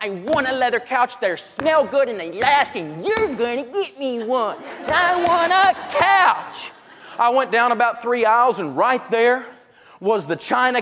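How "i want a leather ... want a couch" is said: high, slow whine ending in a shout; whiny young woman. Retold or imitated. Young woman demanding a leather couch, whiny. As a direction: pout and demand like a spoiled child. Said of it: "she was standing there going".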